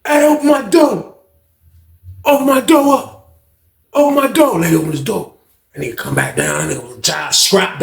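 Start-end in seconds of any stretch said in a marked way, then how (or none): 0.0-4.6 calling out. Retold or imitated. Calling up to guard.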